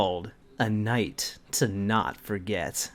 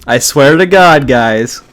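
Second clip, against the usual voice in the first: loud mock plea; 14 dB louder than usual. Mock pleading with audience.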